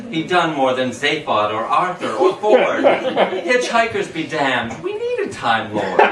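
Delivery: high, laughing giddy reading; can't hold the giggles in. Real, not acted. Reader laughing through the Time Lord finale to the listeners.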